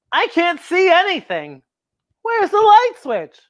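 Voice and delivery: high, anxious whisper-free fret in the dark; high worried kid voice. Acted. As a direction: fret like a kid who can't see. Kids groping in dark closet.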